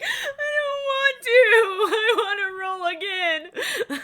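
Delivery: high, teary mock-sobbing child, laughing through it; crying child. Mother imitating daughter crying to roll again. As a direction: whimper like a little girl who lost. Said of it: "And she's like".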